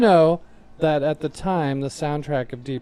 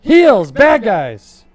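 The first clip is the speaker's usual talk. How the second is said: high, very loud promo shout; hyped announcement; 6 semitones higher, 14 dB louder, much slower than usual. Pretend. Co-host echoing the list title, loud and hyped. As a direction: belt it out to hype the list.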